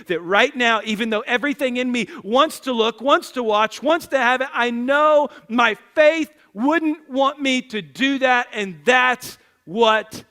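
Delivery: impassioned conviction. Acted. Preacher voices his son at sixteen resisting temptation, with conviction. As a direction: build firmly, slowing to weighty single words. Voicing grown son's future conviction.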